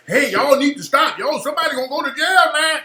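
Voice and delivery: imitated pleading bystander. Host voicing someone telling fighters to stop, mock alarmed plea. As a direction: play a worried onlooker breaking up a fight.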